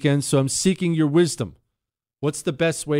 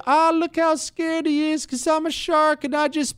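much higher, voicing a gloating shark; smug shark; 13 semitones higher than usual. Host to cohost Chris, imagining a shark's thoughts, high mocking voice. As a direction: sneer as the shark mocking its victim. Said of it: "The shark's used to chasing you".

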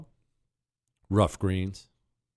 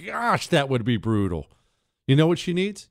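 ordinary talk

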